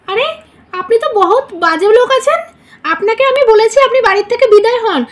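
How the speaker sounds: furious threat to call the police; angry scolding. Acted. Ordering stranger out of bedroom.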